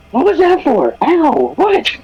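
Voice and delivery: high outraged protest; surprised yelp. Acted. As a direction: yelp after being smacked. Character hit on the nape.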